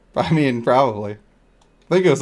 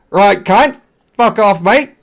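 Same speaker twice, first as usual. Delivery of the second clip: loud, higher hammed-up Australian accent; 6 semitones higher, 7 dB louder, slower than usual. Streamer's crude Australian version for a viewer, Australian accent shouting. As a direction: bark it like a rowdy Aussie. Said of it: "the Australian version".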